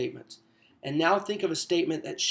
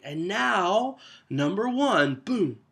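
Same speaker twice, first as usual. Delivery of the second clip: countdown reveal; 5 semitones higher, much slower than usual. Shown as a demonstration. Counting down a top ten.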